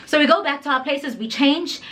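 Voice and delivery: ordinary delivery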